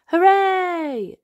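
high, loud happy cheer; cheering hooray. Acted. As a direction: celebrate arriving. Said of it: "everyone cheers".

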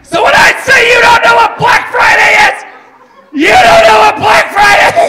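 shrill frantic yelling, high and loud; frantic shout. Retold or imitated. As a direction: scream it like a shopper gone wild.

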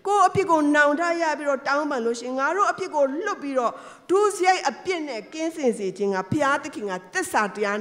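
preacher lifting her voice on God's forgiveness; fervent preaching. Acted. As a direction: proclaim it to the congregation.

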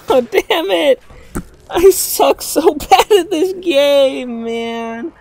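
high, slow, loud wail; whining despair. Real, not acted. Gamer lamenting how bad they are at the game, whining despair. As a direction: wail it out in drawn-out frustration. Just lost the fight.

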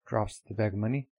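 plain reading voice

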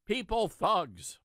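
lightly lampoon the mayor's plea; mock mayor. Host paraphrasing the mayor's demand in a lightly mocking voice. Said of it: "he's saying".

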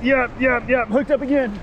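thrilled to be hooked again; excited. Real, not acted. Second fish on the line.

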